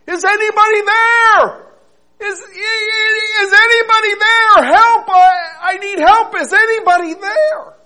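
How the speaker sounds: high, loud cries for help; desperate calling out. Acted. Man hanging from a cliff branch shouting desperately for help. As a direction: yell up the cliff for anyone to hear. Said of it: "he called out".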